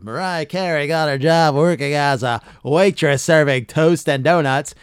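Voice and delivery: loud, booming countdown-host read; Casey Kasem talk-up. Retold or imitated. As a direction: ham up a radio host's hype patter. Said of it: "he'd do the whole talk up like you just heard".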